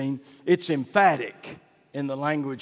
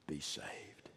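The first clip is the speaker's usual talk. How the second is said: dropped to a whisper, much softer; whispered ending; 11 semitones lower, 18 dB quieter than usual. Acted. Preacher finishing the verse to the church, whispered. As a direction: let the last words fall to a breath.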